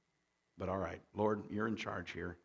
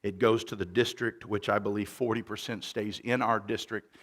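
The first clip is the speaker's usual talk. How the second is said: full-force church announcement; preaching voice; 3 semitones higher, 12 dB louder than usual. Acted. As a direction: project it from the pulpit. Preaching into microphone.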